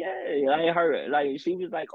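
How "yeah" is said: put-on girly yeah. Girl's naive reply retold.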